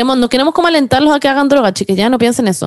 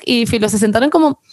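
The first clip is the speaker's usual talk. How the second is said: normal talking voice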